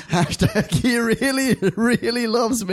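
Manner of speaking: high, slowed, giggly read-out; amused reading. Real, not acted. Host reading Kyle's joke hashtag, amused and laughing. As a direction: draw it out high through laughter.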